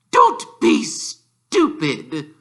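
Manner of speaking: high, loud, slow snapped rebuke; snapping aunt. Acted. Aunt snapping at Harry, high loud slow rebuke. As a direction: bite each word off sharply. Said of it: "snapped Aunt Petunia".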